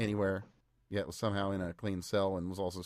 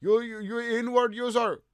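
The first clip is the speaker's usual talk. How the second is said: mocking voice; 12 semitones higher, 7 dB louder, slower than usual. Mocking the accused man.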